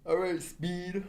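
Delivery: mock hype voice. Imitating streamer Speed.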